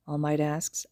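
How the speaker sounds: ordinary delivery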